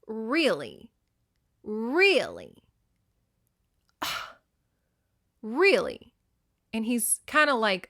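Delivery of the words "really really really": exasperated repeats. Retold or imitated. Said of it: "Tharp's like".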